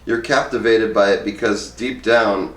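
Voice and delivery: ordinary talk, nothing marked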